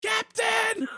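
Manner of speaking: very high, urgent shout; crew yelling. Retold or imitated. Crew warning captain who isn't watching, yelling. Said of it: "everyone else in his crew just yelling".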